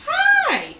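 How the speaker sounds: high excited greeting. Real, not acted. Host greets viewers going live.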